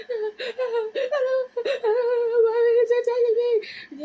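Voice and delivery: very high, loud sobbing scream; hammed-up hysterical bawling; crying like a child. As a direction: wail it like a terrified kid. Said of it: "like a child".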